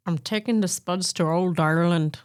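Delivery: put-on old Irish woman's line in a light Irish accent. Shown as a demonstration. Co-host asked to redo the line, in a mild Irish accent. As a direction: give it a lilting Irish accent on request. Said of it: "try and do it again in an Irish accent".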